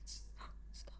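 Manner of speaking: breathy spooky whisper; ghostly whisper. Pretend.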